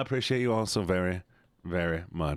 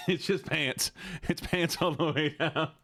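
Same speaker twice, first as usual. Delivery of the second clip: slow, higher, giggly delivery; laughing; 5 semitones higher, slower than usual. Real, not acted. Streamer explaining the costume to viewers, laughing. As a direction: laugh through the words, drawn out.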